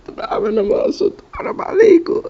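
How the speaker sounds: very high, breathless whispered plea; whispered squeak. Acted. Gamer begging the game in a tiny whispered squeak. Pleading with game after dying.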